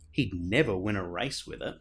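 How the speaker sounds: ordinary delivery, nothing marked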